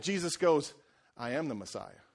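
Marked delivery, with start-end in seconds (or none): 1.2-2.0 quiet. Retold or imitated. Jesus reveals himself.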